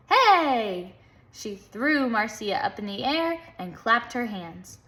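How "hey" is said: loud, high bark of hey; shouted hey. A narrator's reading. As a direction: punch it out like a dance cry. Dance climax shout.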